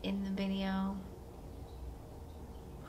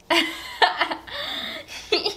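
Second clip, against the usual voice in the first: high burst of laughter. Real, not acted. Reacting to the emo lyrics.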